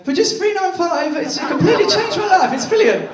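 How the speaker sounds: high gushing advert voice; advert testimonial voice. Retold or imitated. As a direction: ham up a cheesy TV testimonial. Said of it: "Like that".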